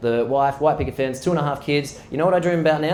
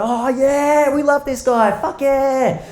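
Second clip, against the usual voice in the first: put-on high, loud kids cheering; 11 semitones higher, 4 dB louder, much slower than usual. Kids cheering their teacher for a fun day, high and loud. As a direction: whoop it up like a class of excited kids.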